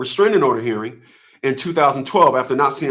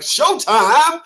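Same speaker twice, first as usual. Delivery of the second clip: hyped; 13 semitones higher than usual. Retold or imitated. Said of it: "they're like".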